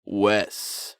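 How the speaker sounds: booming podcast intro; radio host voice. Pretend. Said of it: "Is that a good radio voice?".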